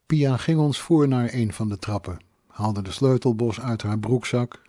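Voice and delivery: ordinary delivery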